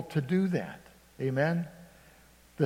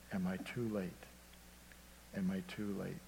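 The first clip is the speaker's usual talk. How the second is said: hushed, lower, worried self-question; anxious inner thought; 6 semitones lower, 13 dB quieter, faster than usual. Retold or imitated. Pastor outside the hospital room fearing he's too late, hushed. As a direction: ask it quietly, dreading the answer.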